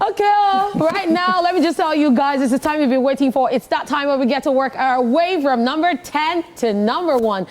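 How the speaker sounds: high energetic countdown announcer; radio host. Acted. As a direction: hype the top ten countdown.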